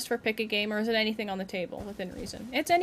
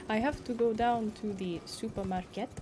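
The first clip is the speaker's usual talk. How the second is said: badly faked French Canadian accent, softer; attempted French Canadian accent; 2 semitones lower, 6 dB quieter than usual. Shown as a demonstration. Streamer tries an accent on request, shaky French Canadian. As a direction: try French Canadian and land near Russian. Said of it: "French Canadian accent".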